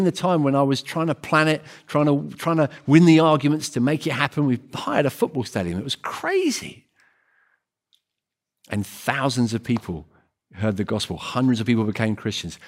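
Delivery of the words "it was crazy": amazed. Real, not acted. Exclaiming about the stadium.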